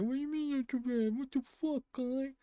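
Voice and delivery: high, fast squeaky outrage; tiny high-pitched voice protesting an insult; indignant character. Pretend. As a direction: play the offended character in a very high voice. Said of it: "It's like".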